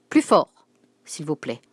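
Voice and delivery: cri sec, plus haut et plus fort; elle exige qu'on parle plus fort; s'écrie. Acted. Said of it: "s'écria la directrice". (s'écrier avec autorité)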